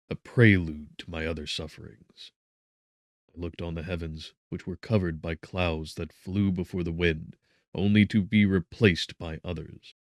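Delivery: ordinary delivery, nothing marked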